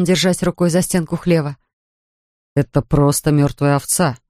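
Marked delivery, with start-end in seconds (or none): none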